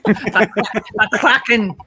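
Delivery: the Kraken in a playful Scottish accent. Shown as a demonstration. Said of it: "that's a good Scottish accent".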